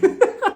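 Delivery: short, high giggle; quick laugh after owning up. Real, not acted.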